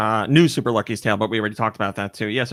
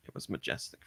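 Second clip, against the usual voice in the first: hushed whisper on majestic; 18 dB quieter than usual. Acted. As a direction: breathe it like a secret. Calling the Eggman games majestic.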